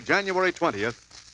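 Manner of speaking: ordinary delivery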